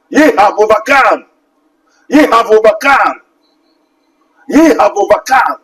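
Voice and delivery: Ye hammered at the start, falling at the end; triumphant repeated declaration; emphatic refrain. Acted. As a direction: drive home Ye have overcome each time.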